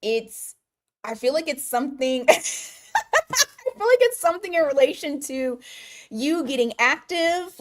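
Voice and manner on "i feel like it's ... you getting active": real high laugh spilling into speech. Real, not acted. Reader cracking up mid-reading for Dawn, laughing. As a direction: break up laughing, pitched well up. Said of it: "that's why I'm laughing".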